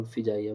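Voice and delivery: فجائیہ said as "fijaiya"; short i in the first syllable of فجائیہ; mispronounced with "fi". Shown as a demonstration. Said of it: "بہت سارے لوگ غلط pronunciation". (فجائیہ کو زیر سے "فِجائیہ" کہنا)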